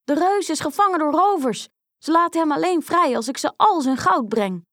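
high-pitched, feigned alarm; urgent calling out. A narrator's reading. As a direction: plead urgently to trick the giant's wife. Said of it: "riep Klein Duimpje". (dringend, hoog en gespeeld paniekerig)

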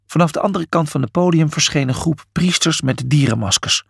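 ordinary delivery, nothing marked

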